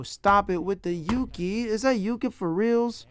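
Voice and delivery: loud, high disbelief at the word; mock-outraged complaint; exasperated. Real, not acted. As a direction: protest as if the app is cheating. Frustrated by a language quiz.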